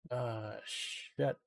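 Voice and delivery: normal talking voice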